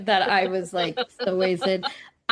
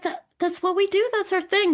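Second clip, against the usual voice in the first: high, playful defence; high playful self-voice; 10 semitones higher than usual. Retold or imitated. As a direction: chirp it like it's obvious. Voicing herself defensively.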